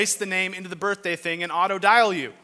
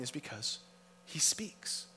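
hushed; 9 dB quieter than usual. Acted. Sermon point, hushed.

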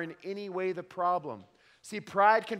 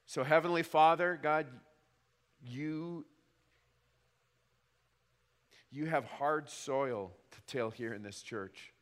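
soft, low earnest prayer; closing prayer; 5 semitones lower, 4 dB quieter than usual. Acted. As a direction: pray it quietly and humbly. Pastor leads closing prayer.

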